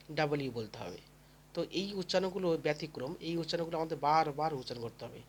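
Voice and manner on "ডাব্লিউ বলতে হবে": ordinary talk, nothing marked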